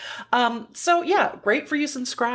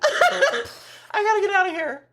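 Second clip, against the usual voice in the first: laughing, voice pitched way up; getting silly; 10 semitones higher than usual. Real, not acted. Co-host ending the stream, laughing out the words. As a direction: giggle through it as the silliness takes over. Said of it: "I'm getting silly".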